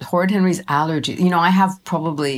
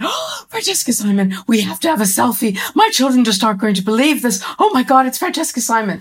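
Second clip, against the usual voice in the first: excited fan; 6 semitones higher than usual. Retold or imitated. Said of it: "Anne Enright went".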